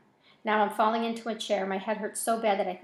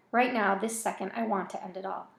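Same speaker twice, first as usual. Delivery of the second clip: usual speaking voice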